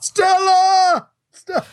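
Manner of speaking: high hammed-up yell of the name; Brando Stella yell. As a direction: bellow it like Brando in the street. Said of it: "Brando came along".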